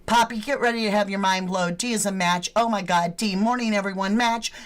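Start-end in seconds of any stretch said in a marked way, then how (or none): none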